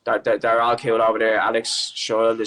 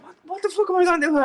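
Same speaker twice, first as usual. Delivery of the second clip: very high, fast, squeaky panic; rattled and squealing at being stuck; panicked disbelief; faster than usual. Retold or imitated. Panicking as key is taken.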